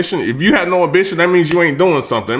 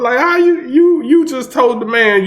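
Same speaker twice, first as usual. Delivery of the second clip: can't believe what he just heard; rising incredulous outburst; 8 semitones higher than usual. Real, not acted. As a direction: pitch jumps up in disbelief. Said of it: "incredulous rising pitch".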